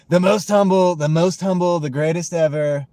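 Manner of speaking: loud, high, hammed-up boasting; mock bragging. Streamer jokingly boasting to chat, loud and high. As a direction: brag it up as a joke, laughing. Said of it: "No, I'm just playing".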